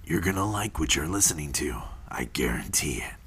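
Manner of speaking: low, deep ad-voice tagline; commercial announcer voice. Acted. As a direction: sell it like a men's suit commercial. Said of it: "That was dope as fuck".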